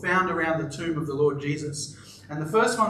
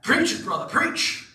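mock yell; 7 semitones higher than usual. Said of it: "One of the deacons yelled out".

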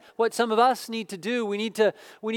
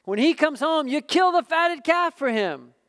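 higher, louder bitter accusation; resentful older son; 6 semitones higher, 4 dB louder than usual. Acted. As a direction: spit out the unfairness. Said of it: "the older brother became angry".

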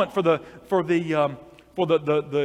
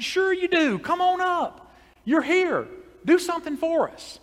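much higher, cheerful coaxing voice; coaxing pastor; slower than usual. Acted. Pastor coaxing the shy farmer to come up, high and cheerful. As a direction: urge him up, bright and high.

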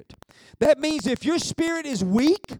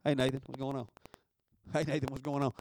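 much softer and lower, gently calling a name; a patient quiet nudge; God calling softly; 11 semitones lower, 10 dB quieter, faster than usual. Retold or imitated. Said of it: "he's like this right here".

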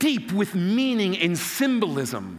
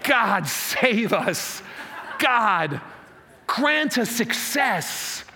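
impassioned prayer; 2 semitones lower, slower than usual. Acted. Pastor prays as the crowd crying to God, slow impassioned plea.

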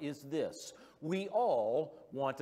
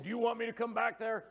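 higher, fast mock-stern dad; a hint of a fed-up father at the wheel; dad threatening kids; 6 semitones higher, 3 dB louder, faster than usual. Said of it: "and so I said".

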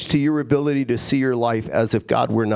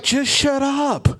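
high-pitched, drawn-out mock complaint; fed-up guest; 8 semitones higher than usual. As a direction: act out an exasperated, hungry dinner guest. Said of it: "some of you would be like".

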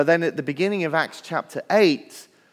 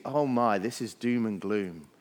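mock gloomy thought; 7 semitones lower, 5 dB quieter than usual. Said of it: "you just think".

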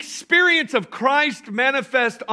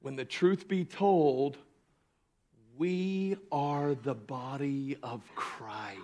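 soft, slow, lower declaration; hushed declaration; 12 semitones lower, 13 dB quieter than usual. Real, not acted. Preacher closing the thought to the church, quiet and slow. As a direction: let it land quietly and slowly. Soft contrast with earlier shout.

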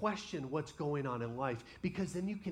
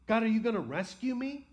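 desperate plea rising to a high, louder Seriously God; pleading to God, pitch climbing; a psalmist's voice crying out, higher and louder; 6 semitones higher, 4 dB louder than usual. Acted. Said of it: "people crying out to God".

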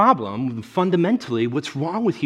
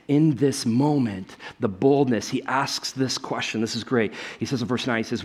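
usual speaking voice

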